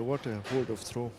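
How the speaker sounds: ordinary delivery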